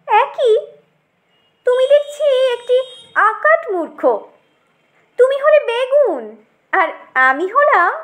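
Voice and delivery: high indignant put-down with dropping asides; haughty tomato, scornful. Acted. Tomato insists she is no mere brinjal, scornful. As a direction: put the upstart firmly in his place.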